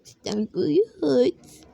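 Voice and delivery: put-on a sick little girl near tears; crying child. Sick daughter complains.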